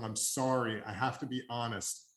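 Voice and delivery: ordinary delivery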